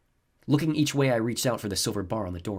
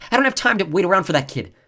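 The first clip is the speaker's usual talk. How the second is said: louder, higher, impatient orders; 10 semitones higher, 9 dB louder than usual. A narrator's reading. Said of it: "Sounding impatient".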